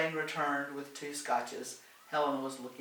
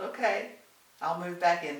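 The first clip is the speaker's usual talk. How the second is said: happy; 5 semitones higher, 6 dB louder than usual. Acted. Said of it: "Doris smiled".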